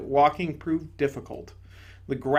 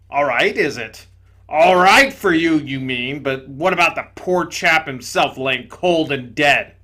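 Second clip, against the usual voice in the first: loud, gruff ghost exclaiming; indignant big ghost; 9 dB louder than usual. Acted. Big ghost to the bright spirit, loud indignation. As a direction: blurt it out loudly, amazed and offended.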